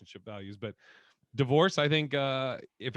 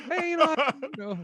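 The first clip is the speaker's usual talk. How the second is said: sneaky dad voice; 8 semitones higher, 3 dB louder than usual. Retold or imitated. Voicing his dad's bet.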